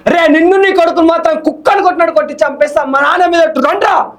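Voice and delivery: angry movie threat; threatening hero line. Shown as a demonstration. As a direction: threaten them like a film hero. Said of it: "అని చెప్పొచ్చు".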